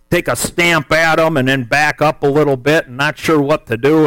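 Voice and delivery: normal talking voice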